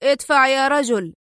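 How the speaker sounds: a chief urging his aide to shove the sofa, urgent shout. A narrator's reading. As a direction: yell at him to push harder. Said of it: "هتف به رئيسه".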